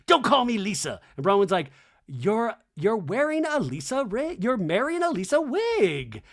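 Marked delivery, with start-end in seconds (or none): 0.1-1.0 mimicked retort. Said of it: "And Mary's like". 2.1-6.2 high, swooping mock disbelief; incredulous. Bronwyn answering Mary in the wig, high mimicked incredulity.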